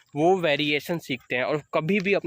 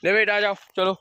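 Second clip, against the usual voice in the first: calling the dog; 5 semitones higher, 4 dB louder than usual. Real, not acted.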